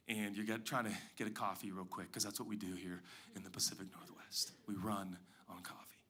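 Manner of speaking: usual speaking voice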